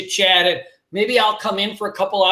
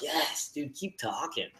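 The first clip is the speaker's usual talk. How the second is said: hushed; 7 semitones lower, 16 dB quieter than usual. Retold or imitated. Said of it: "And you're just like".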